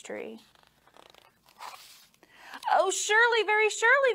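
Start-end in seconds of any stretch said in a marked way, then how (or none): 2.7-4.1 put-on high, sweet boy pleading; sweetly urging little boy; coaxing boy voice. A narrator's reading. Boy voice in story.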